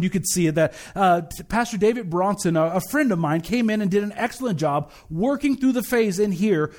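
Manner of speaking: ordinary delivery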